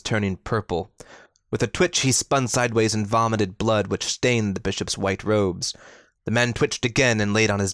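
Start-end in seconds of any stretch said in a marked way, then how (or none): none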